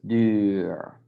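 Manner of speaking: duur with the uu held very long; overdone long UU. Shown as a demonstration. Teacher overdoes it for beginners, very long duur. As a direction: stretch out the rounded vowel in duur. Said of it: "You can make it very long".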